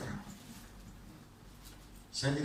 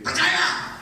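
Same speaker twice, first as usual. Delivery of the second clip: high shouted rebuke; rebuking shout; 11 dB louder than usual. Retold or imitated. Jesus rebuking Martha, a high shout. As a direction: bark the word at her. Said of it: "kepala sambil disundul gini".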